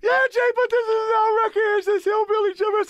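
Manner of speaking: very high, loud whiny wail; mock crying. Rappers whining to their DJ, mock crying. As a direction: bawl the complaint like a kid. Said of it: "we crying again".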